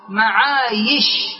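معايش with a held "aa", then "yish"; correct yaa form. Shown as a demonstration. Teacher quoting the verse as proof, معايش with clear yaa. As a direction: glide into y in معايش, no hamza. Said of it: "معايش لا على معائش".

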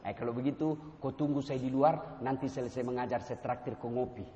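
low, soft, easygoing reprimand; teguran ramah. Acted. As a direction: let the late student down gently. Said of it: "Dia nggak marah". (menegur dengan ramah, suara rendah)

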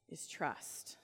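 hushed final word; whisper. Real, not acted. Preacher landing the point in a whisper. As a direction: whisper the answer.

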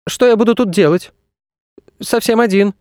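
put-on high-pitched lost-child voice; scared boy. A narrator's reading. Boy alone in empty space, scared. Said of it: "Ему стало страшно".

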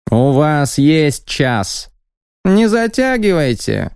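high, slow, squeaky warning; haughty envoy setting a deadline. Acted. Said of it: "высоким, громким и совсем немного писклявым голосом".

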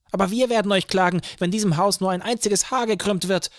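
high, loud, furious threat; choleric shouting. A narrator's reading. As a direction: shout it like a little hothead losing his temper. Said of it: "schrie der kleine Choleriker". (cholerisch geschrien)

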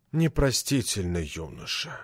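put-on soft, breathy old-man exclamation, slow; lightly voiced breathy reproach from an old boss. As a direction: push the words out on a famous breathy sigh. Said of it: "со своим знаменитым придыханием вскричал".

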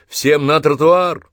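high, slow loudspeaker command; metallic megaphone voice. Acted. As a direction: boom it through a bullhorn. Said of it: "металлический голос".

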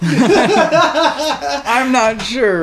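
high, drawn-out mock caller voice; mimicking stoned caller. Friend parroting the funny 911 caller, mocking impression. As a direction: stretch it out high and slow, laughing.

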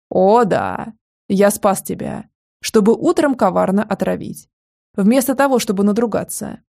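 ordinary delivery

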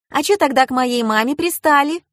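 put-on high-pitched challenging child; young girl. A narrator's reading. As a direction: demand an answer as a protective daughter. Girl challenges her mother's customer.

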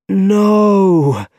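high, loud, drawn-out cry; anguished cry. A narrator's reading. Man seeing the bodies around him, horrified cry. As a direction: cry out long and loud in horror. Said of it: "he cried".

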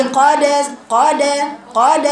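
قاد with a long open a after the qaf; wrong fatha on qalqala. Shown as a demonstration. Teacher shows the mistake: قاد said with an added a. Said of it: "تقول فتحة".

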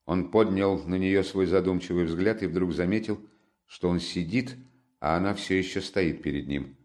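ordinary delivery, nothing marked

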